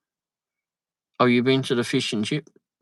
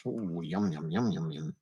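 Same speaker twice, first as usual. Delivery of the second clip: soft yum; 7 semitones lower, 9 dB quieter than usual. Real, not acted. Savoring food pictures.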